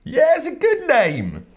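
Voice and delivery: high and slow sarcastic drawl. Pretend. Teasing show name.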